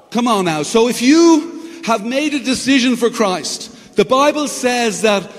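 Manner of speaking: fervent pulpit delivery; preaching. Real, not acted. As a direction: preach it with rising passion. Preaching with rising force.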